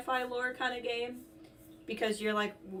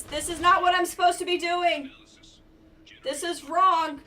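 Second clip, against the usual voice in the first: alarmed; 7 semitones higher, 8 dB louder than usual. Real, not acted. Panics at game going wrong.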